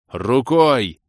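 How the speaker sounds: loud exasperated repeat; near-shout. A narrator's reading. Hero corrects the elf, nearly shouting. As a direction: bark the word in exasperation. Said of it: "Едва не сорвался я на крик".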